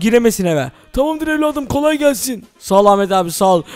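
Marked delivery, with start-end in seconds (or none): 0.9-2.4 put-on higher, softer old man reassuring. Ahmet abi reassures Metin he'll guard the house, softer old voice. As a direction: promise to watch over him, old and soft.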